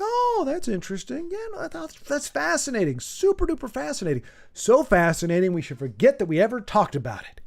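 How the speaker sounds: high, airy and fake-sweet, then settling; patronizing. Shown as a demonstration. Showing a friend her patronizing voice, high and fake-sweet. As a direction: gush over it with false enthusiasm. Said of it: "I'm patronizing you".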